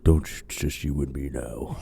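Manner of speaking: low menacing whisper-like tone; creepy killer voice. Retold or imitated. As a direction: say it like a serial killer closing in.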